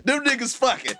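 high-pitched laughing repeat; cracking up through the line. Retold or imitated. Repeats quote while laughing.

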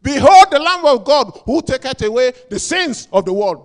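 loud, high prophet's cry; proclaiming shout. Retold or imitated. John the Baptist crying out to the crowd, loud and high. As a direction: announce it like John in the wilderness. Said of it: "What was his ministry".

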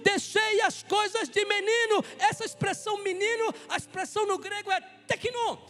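loud, driving sermon delivery; fervent preaching. Acted. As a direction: preach it out to the whole church. Preaching at full force. (pregação fervorosa e alta)

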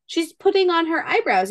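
put-on high matter-of-fact little kid; child voice. Sister explaining makeup to Reagan, high child voice. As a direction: explain it like a know-it-all toddler. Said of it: "Kinsley goes".

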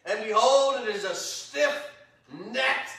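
preacher reads the Lord's words with weight; scripture reading. Acted. Preacher reading God's rebuke of Israel, heavy scripture voice.

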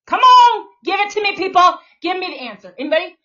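loud, high challenge to the audience; calling out. Real, not acted. Demanding answers from her viewers, loud high challenge. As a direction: shout at the viewers to give it up.

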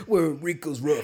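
slow hammed-up trailer announcer. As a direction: boom it out like a movie trailer. Mock movie trailer voice.

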